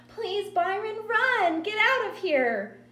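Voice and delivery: put-on high, loud, urgent child's cry; panicked little girl. A narrator's reading. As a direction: beg him to flee, shrieking. Terrified sister urges brother to flee.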